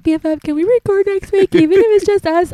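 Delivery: high pleading quote; pleading bff voice. Retold or imitated. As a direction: beg your friend sweetly. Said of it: "I was like".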